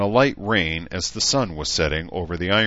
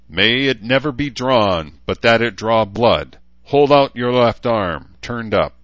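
higher, louder, measured ritual voice; ceremonial declamation; 5 semitones higher, 7 dB louder than usual. Acted. Talbot holding up the new sword, loud ceremonial declamation. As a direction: proclaim it as a solemn rite.

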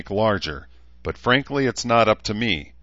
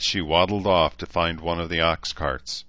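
usual speaking voice

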